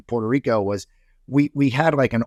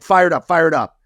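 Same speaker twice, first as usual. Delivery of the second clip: fired up; 6 semitones higher, 6 dB louder than usual. Retold or imitated. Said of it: "I'm like".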